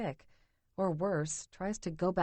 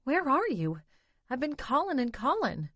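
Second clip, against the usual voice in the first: higher, louder, tearful pleading; a sister crying down the line; sobbing on the phone; 6 semitones higher, 4 dB louder than usual. A narrator's reading. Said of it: "My sister's sobbing".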